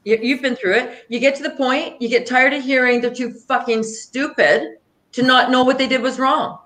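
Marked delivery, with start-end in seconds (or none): none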